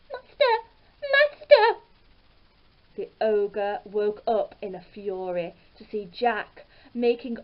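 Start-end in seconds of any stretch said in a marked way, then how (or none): 0.1-1.8 very high, loud calling voice; harp calls out. A narrator's reading. Stolen harp calls for its master, high cry. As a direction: cry for help in a high magical voice. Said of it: "the harp called out".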